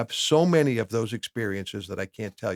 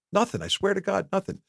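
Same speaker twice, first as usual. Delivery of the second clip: higher-pitched protest of innocence; insistent retold denial; 9 semitones higher than usual. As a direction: deny it quickly, pitch jumping up. Retold denial to suspicious wife.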